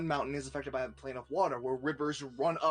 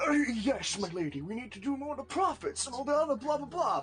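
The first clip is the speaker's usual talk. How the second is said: high, rapid courtier patter; fussing courtier; 10 semitones higher, 3 dB louder than usual. Acted. Courtier pestering the queen with politics, high fast mock chatter. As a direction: babble through court business in a raised voice. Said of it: "You see one go".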